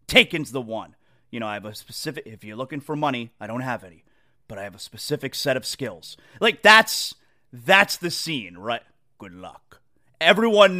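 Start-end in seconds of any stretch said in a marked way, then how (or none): 1.3-6.2 low, quiet, threatening calm; quiet menace, like a hostage call; menacing low voice. Retold or imitated. Quoting Liam Neeson in Taken. 9.2-9.8 very low, quiet threat; menacing low voice. Retold or imitated. Quoting Taken line.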